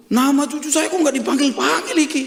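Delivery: high, loud, acted grumble of disbelief; indignant complaint. Grandparent waiting for the grandchild's name to be called, indignant.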